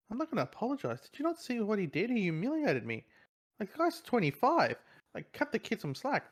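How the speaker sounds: put-on high, indignant teenage whine; whiny kid protest.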